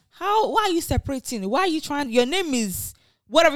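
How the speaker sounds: usual speaking voice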